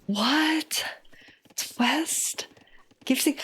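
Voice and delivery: unvoiced surprised whisper. Real, not acted. Streamer reacts quietly to the map, surprised whisper. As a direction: breathe the question out.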